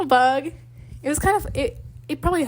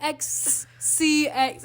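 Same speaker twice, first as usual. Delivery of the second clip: very slow, higher, letter-by-letter "X C X"; halting, stretched-out spelling attempt; struggling to say it; 4 semitones higher, much slower than usual. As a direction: labour over each letter of "X C X". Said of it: "I was, like, really struggling".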